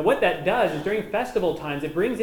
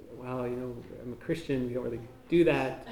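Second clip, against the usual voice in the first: awkward reply; 5 semitones lower, 7 dB quieter than usual. Retold or imitated. Acts out his awkward initial response.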